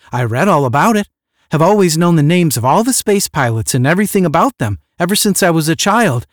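eager gushing. Acted. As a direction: pour it out like a lifelong fan.

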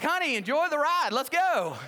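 excited cheer. Retold or imitated. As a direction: whoop like you're on a roller coaster. Telling praying wife to enjoy.

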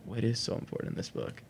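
soft breathy whisper, under the breath; curious about the Bible, whispering the question to himself; whispered wonder. Retold or imitated. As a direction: wonder it to yourself in a hush. Curious inner thought, retold.

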